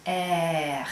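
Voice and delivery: low French "air", made deep in the throat; French R with a throaty rasp; guttural French R. Shown as a demonstration. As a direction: sound the letter R down in the throat. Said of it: "down here deep in your throat".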